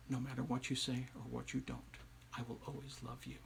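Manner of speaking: low, very soft vow; hushed, loving reassurance; tender husband. A narrator's reading. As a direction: promise it gently, almost fading away.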